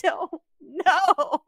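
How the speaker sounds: very slow, laughing helpless shrug; answering the family through laughter. Retold or imitated. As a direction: stretch it out, laughing at yourself. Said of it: "And I'm like".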